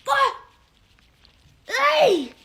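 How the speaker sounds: high, loud jump-scare shout; startled yelp. Acted. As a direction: yelp as if someone grabbed you. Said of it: "This is me if someone's behind me".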